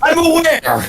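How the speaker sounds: high voice breaking on a hiccup; hiccuping out the words. Real, not acted. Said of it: "I have the hiccup".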